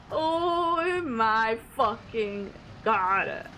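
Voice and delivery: very slow, drawn-out exclamation; long, stunned groan of words; shaken disbelief. Real, not acted. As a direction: stretch every word out, reeling from the scare. Reeling from the jump scare.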